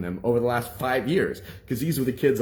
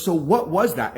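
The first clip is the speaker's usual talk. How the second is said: high, loud demand; shocked anger; 8 semitones higher, 6 dB louder than usual. Retold or imitated. Teacher demanding the boy explain himself, shocked and angry. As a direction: demand an answer, pitch raised. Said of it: "pissed, shocked, and angry".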